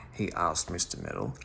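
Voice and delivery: plain reading voice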